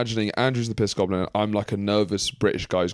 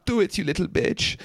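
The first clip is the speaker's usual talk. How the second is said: high goblin taunt; 9 semitones higher than usual. Acted. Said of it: "And he's like".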